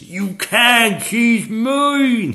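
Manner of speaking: high, loud possessive roar; dragon roar. A narrator's reading. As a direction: roar defiance as a young dragon. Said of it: "he roared".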